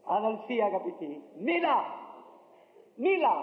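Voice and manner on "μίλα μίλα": high, forceful double command; a preacher urging his congregation, high and forceful; urgent exhortation. Real, not acted. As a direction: push them to speak out. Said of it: "urging the brothers".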